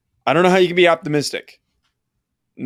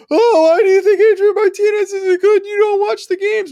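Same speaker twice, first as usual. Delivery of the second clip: high, crying mock fan voice, much higher; mocking whiny fan; 5 dB louder than usual. Mocking Kansas State fans bellowing at him, high whiny cry. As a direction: whine it like a sore rival fan. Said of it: "Kansas State fans were belly-, bellowing over it".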